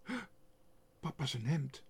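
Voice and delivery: small gasp, then startled thought; mildly alarmed recognition after a gasp. A narrator's reading. Otje spotting her papa's shirt, startled.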